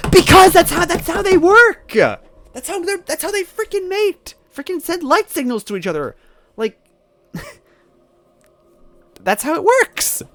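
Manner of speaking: shrieking frustration, high and loud; exasperated yell. Real, not acted. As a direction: yell it in disbelief at the game. Exasperated rant about fireflies.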